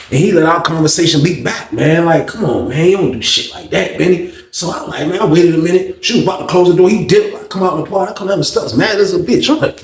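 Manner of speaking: ordinary talk, nothing marked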